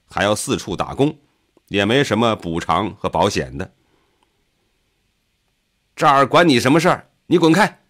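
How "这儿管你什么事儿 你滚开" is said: high-pitched, heated shout; raised voice, hostile and dismissive; angry yell. A narrator's reading. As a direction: yell at a meddler to back off. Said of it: "有人向他吼道".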